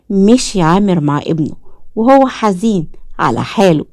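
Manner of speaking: usual speaking voice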